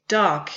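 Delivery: "dark" as one firm, falling beat; single stressed syllable. Shown as a demonstration. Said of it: "only consists of one stressed syllable".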